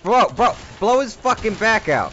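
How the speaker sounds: loud, high urging shout; hyped yelling. Real, not acted. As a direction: yell at the screen. Urging at screen.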